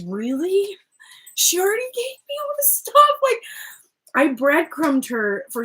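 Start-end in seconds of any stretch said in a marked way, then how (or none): 0.1-3.4 high, squeaky mock disbelief; incredulous. Voicing the masculine doubting himself, incredulous. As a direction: shoot up high and rush it in disbelief. Mocking his need for proof.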